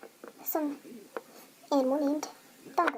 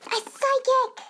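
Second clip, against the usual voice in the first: high, loud, frustrated insistence; loud, high burst of exasperation; exasperated correction; 10 semitones higher, 5 dB louder, much slower than usual. Acted. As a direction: shout it at someone who keeps mishearing. Frustrated correction of a misheard word.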